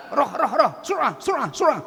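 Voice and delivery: high, shouted commands, like herding goats. Retold or imitated. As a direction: bark it out as if herding goats onto a bus. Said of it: "apa dia bilang?".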